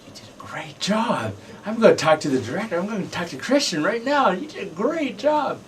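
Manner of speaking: high-pitched impression of a gushing mother; excited mom. Mom praising her son after the premiere, excitedly high-pitched.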